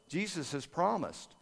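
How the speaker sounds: usual speaking voice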